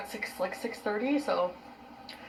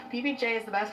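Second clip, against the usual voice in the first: normal talking voice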